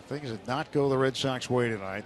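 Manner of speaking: ordinary talk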